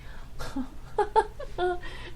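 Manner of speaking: airy, high laugh out of breath; quick unvoiced giggle fading down; breathy laugh. Real, not acted. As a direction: let a soft silent laugh shake out. Laughing at own retold story.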